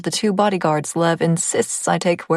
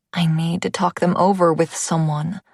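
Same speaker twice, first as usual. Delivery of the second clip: usual speaking voice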